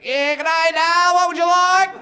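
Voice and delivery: high, loud chirpy shopkeeper greeting; servo attendant voice. Retold or imitated. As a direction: put on a bright high counter-guy voice. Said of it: "he's like".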